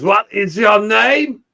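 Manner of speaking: bad German accent. Shown as a demonstration. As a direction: ham up a clumsy German accent, dragging it out. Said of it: "I tried German. It comes out as".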